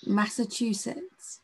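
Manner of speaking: Massachusetts spelled out slowly, syllable by syllable; slow, careful. Shown as a demonstration. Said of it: "you say it".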